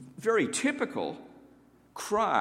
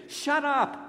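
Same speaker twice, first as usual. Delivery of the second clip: crowd snapping; 10 semitones higher than usual. Retold or imitated. Crowd silencing the beggar.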